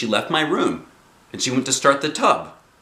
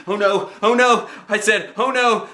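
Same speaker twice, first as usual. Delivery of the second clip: panicked oh no; 12 semitones higher, 4 dB louder than usual. A narrator's reading. Feet coming, panic.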